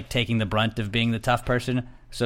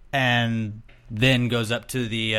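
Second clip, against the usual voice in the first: usual speaking voice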